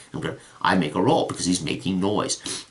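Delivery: ordinary talk, nothing marked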